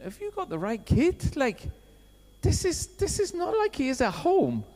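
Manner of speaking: high, incredulous inner voice; can't believe it's my kid; disbelief. Retold or imitated. Retold inner disbelief at teacher.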